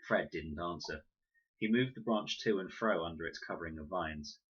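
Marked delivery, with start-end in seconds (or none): none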